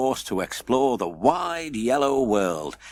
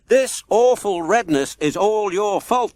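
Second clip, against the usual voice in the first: louder, higher angry crowd; angry shout; 8 semitones higher, 6 dB louder than usual. A narrator's reading. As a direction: hurl the blame at the wizard. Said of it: "they shouted".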